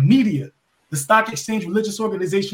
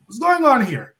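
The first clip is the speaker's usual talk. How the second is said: higher-pitched imitated demand; confronting the boss; 5 semitones higher than usual. Wrestler confronting the boss in his office, imitated demand. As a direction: act out challenging someone in charge. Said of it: "I knock on the boss office like".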